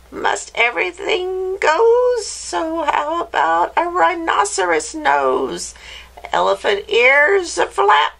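high, excited witch incantation; screechy witch. Acted. Witch finishing the dog's transformation, screechy voice. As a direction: screech the magic words, building to triumph. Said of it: "in her high, screechy voice".

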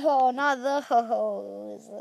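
ordinary talk

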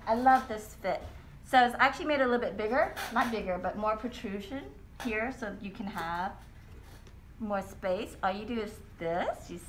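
muffled behind cloth mask; speaking masked; through a face mask. Real, not acted. Seen 2: Cloth mask worn throughout; straw sipping only comes later, from +11.6.

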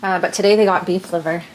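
usual speaking voice

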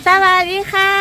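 high, bright singsong hello; sweet greeting. Real, not acted. Mother greets kids home from school.

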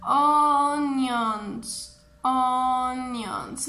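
"onions" stretched long, ON-yons; repeat-after-me model. Shown as a demonstration. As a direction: draw out "onions" so a class can chant along. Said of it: "Una vez más".

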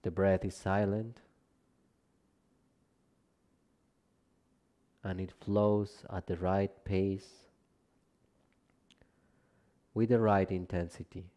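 calm, measured relaxation guidance, phrase by phrase; guided meditation. Acted. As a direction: guide them gently down into rest, unhurried and spacious. Leads a yoga nidra practice.

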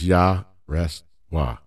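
slow Yah, pause, rest, pause, Wah; three syllables with a silent middle. Shown as a demonstration. Teacher showing the right way, three syllables. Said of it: "So it's three syllables".

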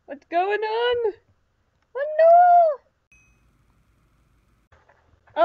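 loud, high cry building to a drawn-out wail; dismayed wail. Real, not acted. Player watching the game crash, wailing in dismay. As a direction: wail in rising panic, stretching it out.

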